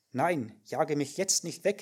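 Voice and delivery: usual speaking voice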